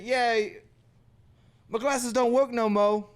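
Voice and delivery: lightly put-on street swagger; mock tough kid. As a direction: imitate a kid covering up with bravado. Imitating student excusing broken glasses.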